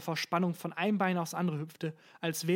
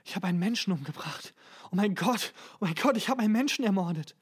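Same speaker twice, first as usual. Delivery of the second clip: distraught stammerer; 3 semitones higher, 4 dB louder than usual. A narrator's reading. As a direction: let the shock mount with each phrase. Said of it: "stotterte er". (zunehmend entsetzt)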